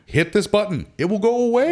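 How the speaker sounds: high, upbeat helpful explanation; bright helpful tip. Retold or imitated. Advising the customer on the service light, high and upbeat. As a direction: sell the easy fix cheerfully. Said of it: "And I'm like".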